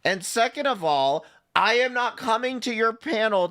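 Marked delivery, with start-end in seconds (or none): none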